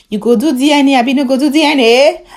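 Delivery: host grilling David on air, loud and pushy; pressing demand. Acted. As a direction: press him hard for an answer.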